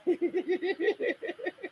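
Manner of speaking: long, soft, high giggle; helpless high-pitched laughter; amused cackle. Real, not acted. Laughs after not blaming them.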